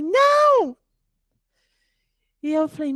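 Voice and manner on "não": put-on high, loud toddler no; stubborn little boy refusing; child's refusal. Son refuses to go talk to his mother, high stubborn shout.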